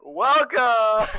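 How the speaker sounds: loud boomy radio-broadcast welcome; in a bunker. Real, not acted. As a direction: greet through a boomy mic. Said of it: "I sound like I'm in a bunker".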